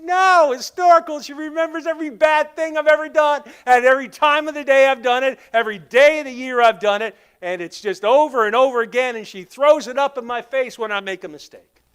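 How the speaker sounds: high, loud protest, easing gradually; exasperated husband. Acted. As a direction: launch an acted tirade, piling up the grievances. Husband's complaint in a joke.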